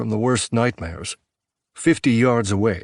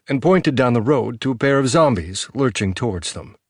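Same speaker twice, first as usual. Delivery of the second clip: ordinary delivery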